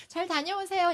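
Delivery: high, sweet send-off; playing mom. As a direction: play along as the pretend wife. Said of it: "이제 역할 놀이니까".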